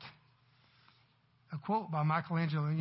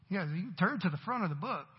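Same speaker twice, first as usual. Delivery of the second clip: usual speaking voice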